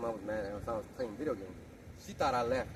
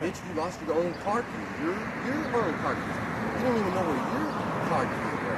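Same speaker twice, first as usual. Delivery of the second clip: mock scolding; 6 semitones higher, 6 dB louder than usual. Retelling a fight with his wife.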